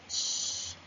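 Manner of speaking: "sh" as a long, unvoiced hiss with rounded lips; sh sound. Shown as a demonstration. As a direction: hold "sh" as a soft voiceless hiss. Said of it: "the sound".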